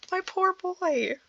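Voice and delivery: high, slow, soft and pitying; tender pity. Real, not acted. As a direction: reader pitying the shaken hobbit, softly and slowly. Reader pities the shaken hobbit.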